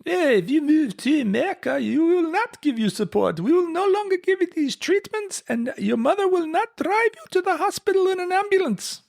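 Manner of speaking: bad high-pitched French accent; a poor, high attempt at a French accent. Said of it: "Do it in a French accent".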